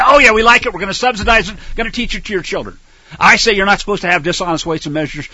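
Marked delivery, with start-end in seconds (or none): 0.0-2.8 loud, higher sneering voice of the defiant nation; mock them as brash and proud. Preacher mocks America answering God, loud and sneering. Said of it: "You say".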